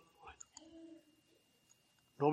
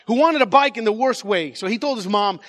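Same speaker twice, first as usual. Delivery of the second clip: usual speaking voice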